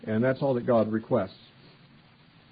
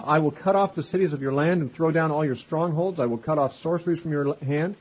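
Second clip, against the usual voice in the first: scripture reading; 3 semitones higher than usual. Acted. Preacher reading God's threat to the nations, raised and declaiming. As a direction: read it out like the prophet speaking.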